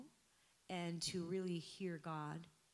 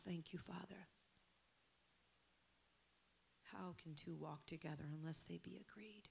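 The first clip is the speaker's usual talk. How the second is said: whispered prayer, much softer; hushed whisper; 10 dB quieter than usual. Real, not acted. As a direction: breathe it to God in a whisper. Hushed prayer after decree.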